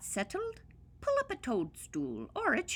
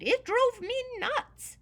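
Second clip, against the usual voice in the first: exasperated; 6 dB louder than usual. A narrator's reading. Exasperated leprechaun.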